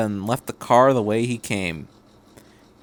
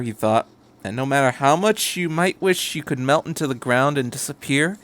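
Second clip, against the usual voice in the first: ordinary delivery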